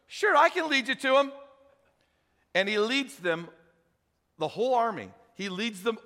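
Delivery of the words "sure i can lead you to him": higher, bright, too-helpful offer; cheerful helpfulness. Retold or imitated. Prophet offering to lead the blind army, higher and sly. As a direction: play the sly helper. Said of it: "He goes".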